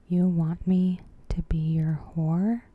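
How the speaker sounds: normal talking voice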